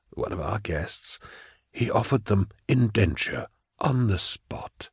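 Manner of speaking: smooth, quiet official relishing his announcement; unctuous prefect. Acted. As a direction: savour the news softly. Prefect tells her the news.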